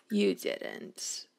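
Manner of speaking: breathy, toneless whisper. Real, not acted. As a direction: drop it to a disbelieving whisper. Scolding the dog in game.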